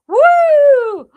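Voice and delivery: high, loud whoop of glee; excited whoop. Real, not acted. Guest whooping at the host's peg game, loud excited. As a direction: let out a big laughing cheer. Laughing cheer at a joke.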